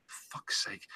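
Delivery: whispered swear under the breath; muttered curse. Real, not acted. Reader stumbling over a word, muttered curse. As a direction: curse yourself almost silently. Annoyed at own reading slip.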